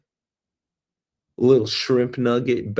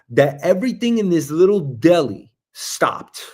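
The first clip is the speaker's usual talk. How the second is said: ordinary talk, nothing marked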